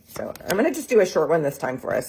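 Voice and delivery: ordinary talk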